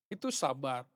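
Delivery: ordinary talk, nothing marked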